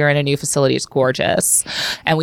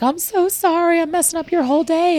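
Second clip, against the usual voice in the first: put-on high, small apologetic old woman; apologetic patient voice; 13 semitones higher than usual. Doctor voicing her elderly patient's apology, high and meek. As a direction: voice the sweet old patient fretting.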